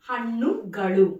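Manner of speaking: హన్నుగలు said loudly with retroflex nn and l; emphatic హన్నుగలు, nn as ణ and l as ళ. Shown as a demonstration. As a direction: model హన్నుగలు firmly, tongue curled back. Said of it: "అనా పలకాలి".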